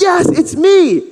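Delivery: high, loud cheerful insistence; excited yes. Acted. Healed man assuring neighbors, high and excited. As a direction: beam it out. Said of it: "He's like".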